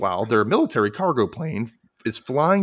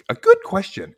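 lightly voiced cartoon; 5 dB louder than usual. Voicing Doctor Stupid.

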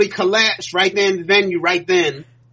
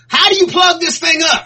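high, loud confused yell; panicked shout; 11 semitones higher, 4 dB louder than usual. Retold or imitated. Panicked staff with nebulizer.

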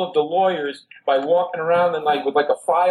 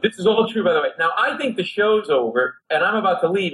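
usual speaking voice

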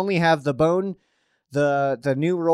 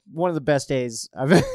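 ordinary talk, nothing marked

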